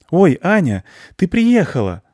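high, loud happy cry; delighted greeting. Acted. Friend greets Anya who has just arrived, high and delighted. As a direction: greet her in a high, excited voice.

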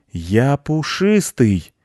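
high, slow, drawn-out cat protest; alarmed, offended cat; outraged cat. A narrator's reading. Cat protests again at being eaten.

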